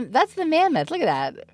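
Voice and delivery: ordinary delivery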